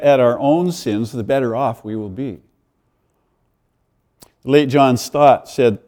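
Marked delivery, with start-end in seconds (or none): none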